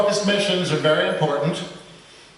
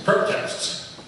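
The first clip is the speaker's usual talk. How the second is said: normal talking voice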